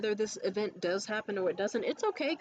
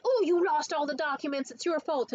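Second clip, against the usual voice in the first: high, loud accusing boss; 6 semitones higher, 4 dB louder than usual. Retold or imitated. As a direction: blame them, pitched up. Mocking boss blame.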